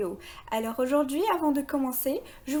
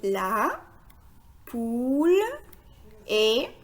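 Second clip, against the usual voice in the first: one word at a time, pauses between; word-by-word reading; much slower than usual. Shown as a demonstration. Teacher leads beginners through the sentence, word by word. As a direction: read slowly, each word standing alone. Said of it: "on va essayer de lire ensemble la phrase".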